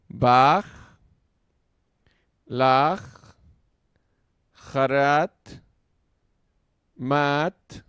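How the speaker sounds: each Hebrew word read out slowly and loudly, one at a time; reading Hebrew words aloud. Shown as a demonstration. Teacher reading a word list aloud to students, loud and slow. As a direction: sound out every word clearly for the class. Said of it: "Vamos leyendo de la derecha a la izquierda".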